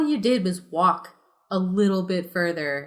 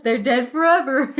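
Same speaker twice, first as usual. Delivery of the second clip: laughing; 6 semitones higher, 4 dB louder than usual. Pretend. Laughing joke line.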